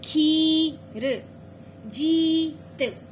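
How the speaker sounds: लंबी ई खींचकर, बहुत धीरे; slow, drawn-out teaching. Shown as a demonstration. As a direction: hold the long ई for the child. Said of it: "दो अक्षर वाले शब्दों का अभ्यास कराना है".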